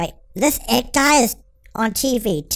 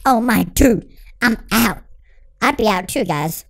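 ordinary talk, nothing marked

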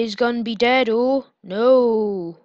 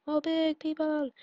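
ordinary talk

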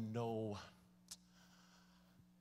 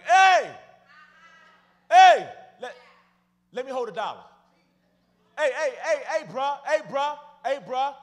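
loud, high hollering for change; street panhandler; 11 semitones higher, 21 dB louder than usual. Acted. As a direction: imitate a beggar calling insistently to passersby. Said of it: "You know what it sounds like".

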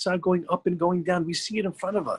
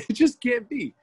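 laughing disbelief; 8 semitones higher, 3 dB louder than usual. Retold or imitated.

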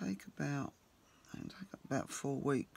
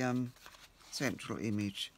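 ordinary talk, nothing marked

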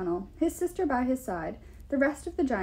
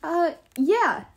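higher, louder hesitant reply; calling back; 6 semitones higher, 6 dB louder than usual. A narrator's reading. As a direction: call back uncertainly, raising your voice. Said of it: "I called back".

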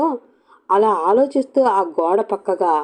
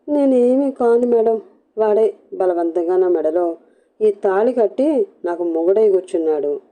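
ordinary delivery

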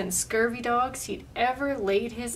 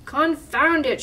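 raised, higher pirate outburst; shouted; 4 semitones higher, 6 dB louder than usual. A narrator's reading. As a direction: burst out loudly, pitched up. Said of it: "shouted Pete".

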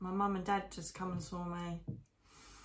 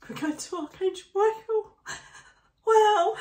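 much higher, louder, breaking with emotion; overwhelmed cry; 13 semitones higher, 10 dB louder than usual. Real, not acted. Medium realizing she meets Archangel Michael, crying out overwhelmed.

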